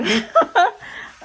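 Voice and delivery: loud high burst of laughter. Real, not acted. Reader amused at her deck remark, laughing.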